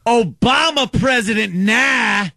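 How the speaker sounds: hammed-up street heckler; imitating a heckler. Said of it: "as he yelled to me".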